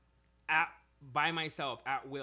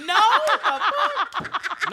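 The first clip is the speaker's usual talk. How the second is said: mock outraged denial; 17 dB louder, much slower than usual. Outraged denial in retold scene.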